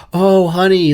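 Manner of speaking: a hint of a wheedling wife; imagined wife. Wife to painter husband about holes in the walls, lightly imitated.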